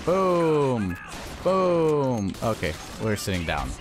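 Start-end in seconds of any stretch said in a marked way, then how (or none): 0.0-2.3 high, long falling boom; drawn-out boom. Real, not acted. Gamer calling out each hit, high drawn-out boom. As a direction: stretch it out, sliding down from high.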